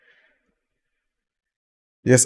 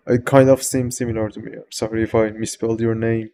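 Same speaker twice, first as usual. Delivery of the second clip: usual speaking voice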